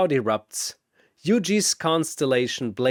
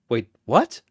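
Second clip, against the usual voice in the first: startled double take; caught off guard; 4 dB louder than usual. Acted. Shazam thrown by Chen's sudden wager, startled. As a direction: blurt it out.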